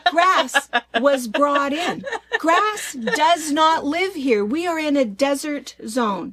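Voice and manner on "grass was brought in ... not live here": slow, loud, high and insistent; emphatic rant. Real, not acted. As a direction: hammer each word home. Passionate rant, slowed and loud.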